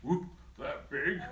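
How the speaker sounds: slow, nervous gulp; gulping elephant. Acted. Elephant gulping at how big Big Bro is. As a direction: swallow hard on it. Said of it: "gulped the elephant".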